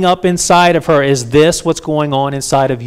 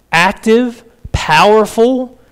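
rising fervor; 5 semitones higher, much slower than usual. Real, not acted. Preacher building intensity.

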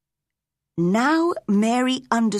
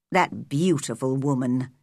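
low, regretful man; sombre, deep-pitched male character; man's voice; 7 semitones lower than usual. A narrator's reading. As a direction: mourn her in a low pitch. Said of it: "she heard men's voices".

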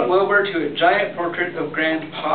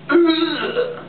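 disgusted ugh; 12 semitones higher than usual. Acted. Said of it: "said the other naked Morats".

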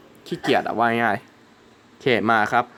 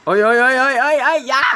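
high, loud panicked shouts; frantic gamer scream as the boss charges; panicked yelling; 10 semitones higher, 5 dB louder than usual. Real, not acted. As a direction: yell in a rising panic at the screen. About to be hit by boss.

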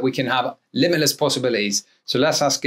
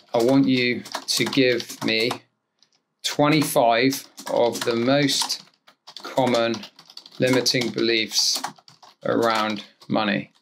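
slow dictation; 3 semitones lower, 3 dB quieter, slower than usual. Acted. Dictating prompt to software.

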